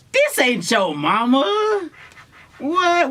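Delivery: high, slow, stunned voice; shocked disbelief. Retold or imitated. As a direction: squeal in disbelief. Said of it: "And I'm like".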